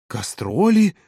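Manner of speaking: high rising echo of disbelief; astonished. A narrator's reading. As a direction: repeat the word in amazement, pitch up. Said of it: "От удивления Ирина аж прикрыла рот рукой". (удивлённый переспрос высоким голосом)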